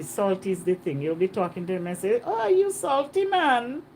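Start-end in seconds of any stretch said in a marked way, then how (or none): 2.2-3.8 high, drawn-out teasing exclamation; islander impression. Islander talking to a visitor, imitated island speech. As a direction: mimic a local with a long, lifted call. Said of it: "Yeah, that's how they speak".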